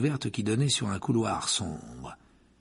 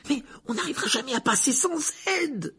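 panicked; 3 dB louder than usual. Acted. Hermione panics at the tree.

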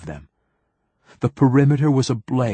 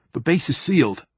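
lightly voiced higher young soldier; a hint of a youthful lieutenant, pitched up; young marine; 6 semitones higher than usual. A narrator's reading. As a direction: state it as an anxious junior officer. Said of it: "the voice sounded young".

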